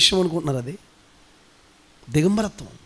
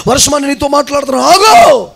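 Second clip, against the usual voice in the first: commanding; 6 semitones higher, 7 dB louder than usual. Shown as a demonstration. Preacher showing how to order the rain to stop, commanding shout. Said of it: "అంతేగాని ఏడుస్తా".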